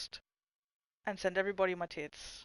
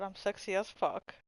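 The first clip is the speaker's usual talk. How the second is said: usual speaking voice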